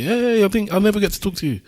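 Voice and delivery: high-pitched friendly hail; eager acquaintance. Retold or imitated. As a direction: imitate someone calling out on catching up. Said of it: "somebody caught up with me".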